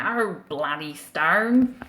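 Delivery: put-on slow, loud Australian accent; hammed-up Australian exclamation. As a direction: stretch it out like an Australian. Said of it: "Very Australian there".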